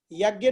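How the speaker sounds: यज्ञ as "yag-gye"; hard g in यज्ञ; rejected pronunciation. Shown as a demonstration. Teacher warns students off the habit, "gy" pronunciation. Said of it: "नहीं बोलना चाहिए हमको".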